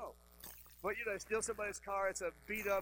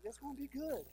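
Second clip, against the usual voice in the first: higher, breezy designer voice; lightly voiced cheerful designer; upbeat designer; 8 semitones higher, 3 dB quieter than usual. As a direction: sell it like an eager designer. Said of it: "the designer says".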